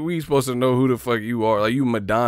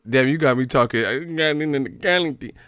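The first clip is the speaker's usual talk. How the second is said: usual speaking voice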